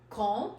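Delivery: comb said as kom, no b at the end; teacher modelling comb with a silent b. Shown as a demonstration. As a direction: drop the final b in comb. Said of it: "तो हम लोग कैसे कहते हैं".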